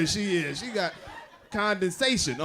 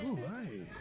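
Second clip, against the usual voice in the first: hungry moan; 13 dB quieter than usual. Retold or imitated.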